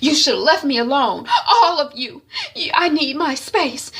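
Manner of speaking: high, loud protest; upset outburst. Retold or imitated. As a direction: snap at a sister in acted exasperation. Sister explodes, storms out.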